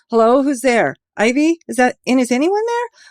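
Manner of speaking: high, loud panicked calls from below; frightened calling out. Acted. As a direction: call up in terror, not knowing who's there. Man trapped on cliff calling.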